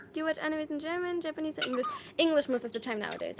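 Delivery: ordinary talk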